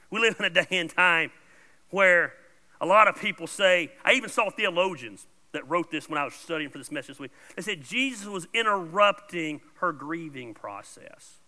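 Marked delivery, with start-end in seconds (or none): none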